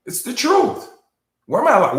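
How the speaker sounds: loud, high-pitched protest; emotional outburst. Real, not acted. Speaker defending himself to doubters, emotional shout. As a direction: raise your voice sharply to insist on it. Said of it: "I get emotional".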